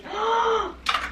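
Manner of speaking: sharp high gasp; excited gasp. Real, not acted. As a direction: gasp in delight. Gasp at fangs.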